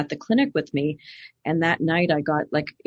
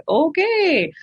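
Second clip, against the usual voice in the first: high, drawn-out reluctant okay; a hesitant, humoring okay from the cautious husband; lightly voiced skeptical husband; mock husband; 12 semitones higher, 5 dB louder than usual. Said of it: "And he's like".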